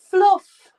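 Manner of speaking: loud, high attempt at a Scottish "Fluff"; Scottish accent. Shown as a demonstration. Woman trying Scottish for Ange, loud mock Scottish accent. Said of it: "Can't say it in a Scottish accent".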